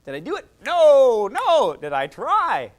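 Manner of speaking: slow, loud, stretched questions; mock inner voice of a learner; drawn-out self-questioning. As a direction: drag each word as you ask yourself. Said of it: "I didn't go".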